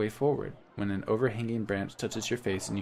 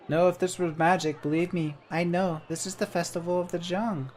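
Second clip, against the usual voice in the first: a tiny bird-sized helper, cheerful; chirpy little creature; 7 semitones higher than usual. Acted. Jan introduces himself to the traveller, high and chirpy.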